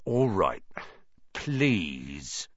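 drawn-out reluctant please, dropping low; grudging. A narrator's reading. Filch forced to say please, grudging. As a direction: give in through your irritation.

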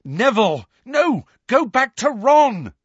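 high, loud warning cry; urgent shout. A narrator's reading. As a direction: yell to send a friend back. Harry calls urgently to Neville.

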